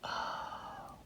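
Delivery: breath-soft, whispered retold oh; tiny stunned whisper with long gaps; hushed realization. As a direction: let it sink in, barely voiced. Retold hushed realization about herself.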